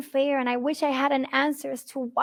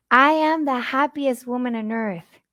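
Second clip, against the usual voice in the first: ordinary talk, nothing marked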